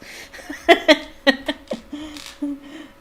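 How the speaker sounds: high amused laugh. Real, not acted. Reader laughing at Ron's joke, bright laugh. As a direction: break into a laugh.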